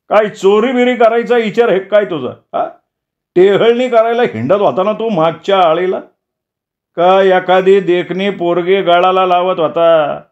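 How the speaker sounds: high-pitched suspicious interrogation; accusing villager. Acted. Villager accuses the captive of scouting for theft, high accusing voice. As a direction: fire accusations in a high voice, slowing into a threat. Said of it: "सुभान्यानं त्याच्या मनातली मळमळ ओकून टाकली".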